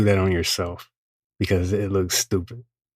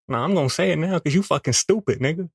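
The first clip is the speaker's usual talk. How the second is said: insistent raised voice; 7 semitones higher, faster than usual. Acted. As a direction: blurt it out defiantly. Inner voice insisting loudly.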